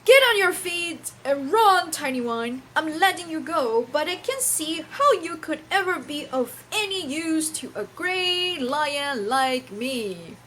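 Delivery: loud, booming lion dismissing mouse. Acted. The lion sets the mouse free, loud proud booming voice. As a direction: roar it out with lordly scorn. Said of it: "He said".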